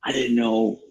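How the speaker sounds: ordinary talk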